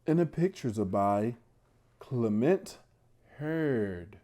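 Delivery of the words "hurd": ordinary delivery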